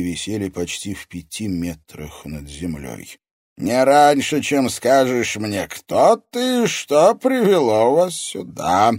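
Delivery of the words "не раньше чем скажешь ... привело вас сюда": slow, loud, raised villain voice; booming interrogation by an evil sorcerer; menacing sorcerer. A narrator's reading. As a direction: demand it slowly and imperiously.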